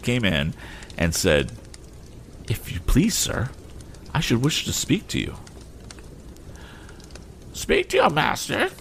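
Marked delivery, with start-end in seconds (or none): 2.5-5.4 a hint of a nervous housemaid. Housemaid voiced. 7.5-8.7 shrill, much higher indignant lady; haughty lady. Acted. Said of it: "whose dignity was roused again".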